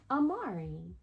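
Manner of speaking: plain reading voice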